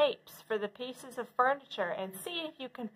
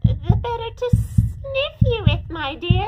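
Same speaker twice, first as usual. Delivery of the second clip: much higher, louder sly grandma voice; wolf as grandma; 12 semitones higher, 9 dB louder than usual. Acted. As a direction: answer sweetly but slyly.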